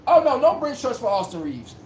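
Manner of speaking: ordinary talk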